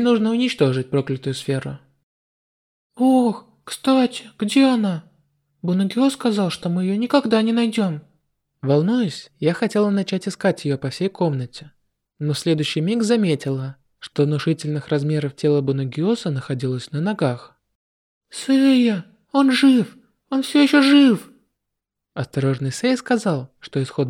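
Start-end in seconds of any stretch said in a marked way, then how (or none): 3.0-8.0 put-on higher girlish voice; young heroine, relieved; girl's voice. 18.3-21.3 high, loud alarmed cry; alarmed shout. Acted. Heroine cries alarm.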